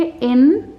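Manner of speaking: "Insect" with a held इन, then a gap; syllable by syllable. Shown as a demonstration. Teacher has viewers feel the chin, "insect" in syllables. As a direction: drop the jaw once for each half of "insect". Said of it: "तो बोल के देखिए".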